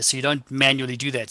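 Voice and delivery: usual speaking voice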